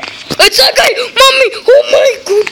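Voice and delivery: loud, high shriek of fright; startled, near-crying yell; panicked screaming. Real, not acted. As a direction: scream for help in a sudden scare. Scared by player in game.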